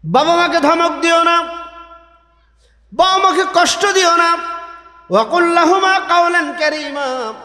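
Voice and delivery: high, impassioned sermon delivery; fiery waz preacher calling out; preaching at full force. Acted. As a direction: preach it out to the whole gathering.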